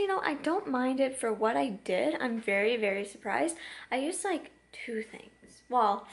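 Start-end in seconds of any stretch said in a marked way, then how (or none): 3.9-5.6 whispered aside to camera; whispering. Real, not acted. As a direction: whisper it like a little secret. Confiding aside.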